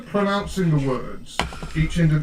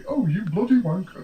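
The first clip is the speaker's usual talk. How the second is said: posh British; 4 semitones higher than usual. Shown as a demonstration. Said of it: "you can't say it too posh".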